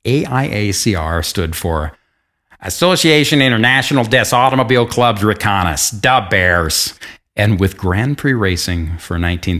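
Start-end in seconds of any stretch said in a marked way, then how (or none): 2.6-6.9 loud, higher, hammed-up Chicago accent; French words in broad mock Chicago; Chicago Bears-fan accent. Shown as a demonstration. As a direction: read French like a Chicago superfan. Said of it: "read this in a Chicago da Bears accent".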